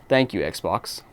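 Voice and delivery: normal talking voice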